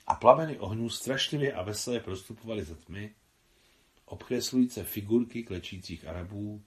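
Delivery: usual speaking voice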